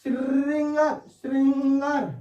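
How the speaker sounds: श्रृंगार high and drawn out on its first syllable; exaggerated wrong sound. Shown as a demonstration. Teacher testing a doubled r, श्रृंगार exaggerated and stretched. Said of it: "तो दो रा एक साथ आ सकते हैं".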